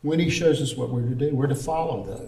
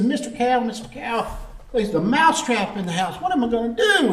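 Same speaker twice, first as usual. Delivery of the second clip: higher-pitched mock-anxious mouse voice; worried mouse; 8 semitones higher than usual. Mouse warning the cow about the trap, acted worry. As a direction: play a little mouse pleading, rising at the end.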